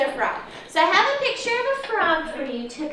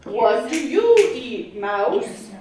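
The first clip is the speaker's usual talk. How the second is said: wide-mouthed frog voice; 5 semitones lower than usual. Acted. Teacher voicing the frog for the class, low and booming. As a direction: boom it out like a proud frog. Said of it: "said the wide-mouthed frog".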